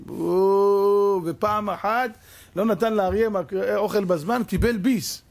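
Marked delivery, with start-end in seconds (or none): 0.1-1.2 sudden loud yelp, hammed up; loud mock cry of pain. Noah bitten by the lion, a loud mock yelp.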